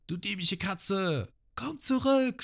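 hoch und langsam gerufen; Genzo ruft. Retold or imitated. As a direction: ruf ihr empört hinterher. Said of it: "Genzo schreit". (Genzo ruft der Diebin hoch und langsam hinterher)

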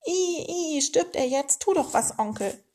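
high squealing plea for help; acted shrieky panic at moderate volume; panicked screeching. A narrator's reading. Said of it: "und kreischten los".

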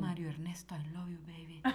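whispered mock declaration of love. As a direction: breathe it softly like a secret crush. Whispered love note to friend.

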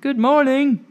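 high, loud call of "Good morning!"; shouted greeting. Acted. Fiona calling through the window to the baker, loud and high. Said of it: "rapped on the window and shouted".

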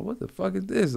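sudden panic at what he sees; replaying horror at his cellmate; retold alarm. Retold fright at dying cellmate.

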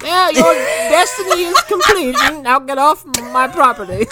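high, loud granny through a laugh; old lady voice. Retold or imitated. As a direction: shoo him off as a cranky old woman. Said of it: "She's like".

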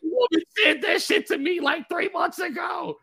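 high-pitched disbelieving yell; shocked outburst. Retold or imitated. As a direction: replay your astonishment, climbing into a squealed shout. Acted stunned reaction to the reading.

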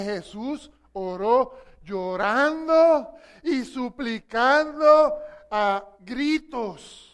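loud, very slow scripture reading; slow emphatic reading. Real, not acted. Preacher to congregation, slow loud emphatic scripture. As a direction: read it slowly, weighing every word. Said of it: "Me gusta cómo lo dice esa versión".

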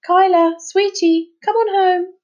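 high, loud sugary call; sweet mum calling. A narrator's reading. Mother calling her daughter home, sweet call.

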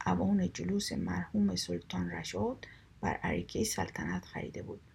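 usual speaking voice

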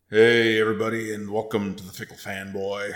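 loud, booming host welcome; big showman welcome; podcast host intro. Acted. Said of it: "the full treatment".

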